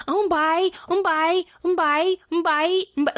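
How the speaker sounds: high squawky begging; seagull kids. Retold or imitated. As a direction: beg like hungry seagulls. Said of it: "like seagulls".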